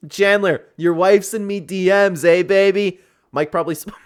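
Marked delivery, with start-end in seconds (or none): 0.1-3.0 higher, louder, slow Irish brogue, teasing; mock Irish McGregor taunt. McGregor taunting Chandler about his wife, cocky Irish brogue. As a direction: lean into a cocky Irish drawl on the DMs line. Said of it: "we're gonna hear something like".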